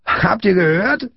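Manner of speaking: high, drawn-out crowing question; gleeful jeer. A narrator's reading. Big boy to his gang about Friedrich, gleeful jeer. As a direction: crow to your gang.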